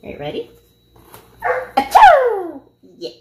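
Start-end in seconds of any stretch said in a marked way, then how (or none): 1.8-2.6 loud high pretend sneeze; hammed-up achoo for the dog; fake sneeze.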